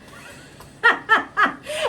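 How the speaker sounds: high burst of laughter; laughing. Real, not acted. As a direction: break into a loud laugh. Laughs at own advice.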